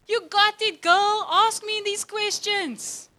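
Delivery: high, loud, joyful burst with a laugh; God celebrating. Retold or imitated. God cheering on a believer, beaming and loud. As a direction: cheer her on like a delighted friend. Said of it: "He's like".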